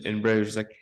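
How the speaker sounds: ordinary delivery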